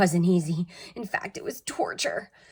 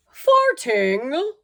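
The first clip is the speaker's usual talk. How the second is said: higher, louder doctorly voice; the vet; 7 semitones higher, 8 dB louder than usual. A narrator's reading. Vet diagnosing Walter, higher and louder. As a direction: pronounce it like a diagnosis. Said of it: "said the vet".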